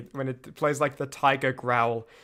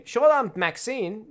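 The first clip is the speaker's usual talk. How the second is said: tries Short-armed Maxine with a rising, careful read; testing the name in an American accent; 6 semitones higher, slower than usual. Shown as a demonstration. Streamer tries renaming the character to test the name, careful American read. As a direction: sounds out Short-armed Maxine slowly, checking how it flows. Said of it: "I should have named her".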